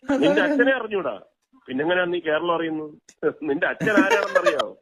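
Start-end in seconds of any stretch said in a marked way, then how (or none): none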